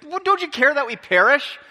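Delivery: a high, loud, alarmed cry over the storm; panicked plea. Retold or imitated. Disciples crying out to sleeping Jesus, panicked and loud. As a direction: plead like men about to drown.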